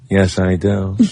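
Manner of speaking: a hint of the deadpan engineer; imitating the engineer. Host mimicking the chief engineer's answer, lightly deadpan. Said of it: "And he talks like this".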